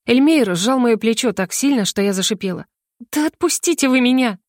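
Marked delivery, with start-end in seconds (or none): 3.1-4.4 higher, sharp cry to let go; a shoulder squeezed hard, she protests; pained protest. A narrator's reading. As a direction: snap it out in pain. Gripped painfully, protests.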